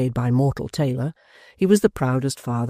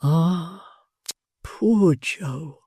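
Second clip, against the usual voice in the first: musing sorrowfully; 4 semitones higher, much slower than usual. A narrator's reading. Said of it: "musing sorrowfully".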